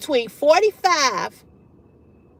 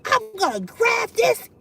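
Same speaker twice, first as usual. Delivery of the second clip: higher, laughing mock rage; mock furious threat; 8 semitones higher than usual. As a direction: imitate the girlfriend ready to fight. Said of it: "she was going off".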